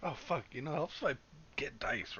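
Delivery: ordinary talk, nothing marked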